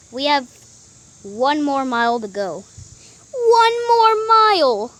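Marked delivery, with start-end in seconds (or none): 3.3-4.9 loud, drawn-out groan of dismay; dismayed shout. Acted. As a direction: wail it in disbelief. Dismayed at the distance left.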